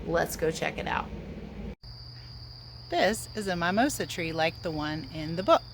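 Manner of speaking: usual speaking voice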